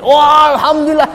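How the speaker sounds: high, loud, fast cry of relief; overjoyed husband. Acted. Husband thanking God on hearing of a son, high loud cry. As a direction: burst out in joy like the relieved father.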